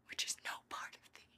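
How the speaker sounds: soft breathy whisper. Acted. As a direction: whisper it like a secret vow. Intimate closing of the speech.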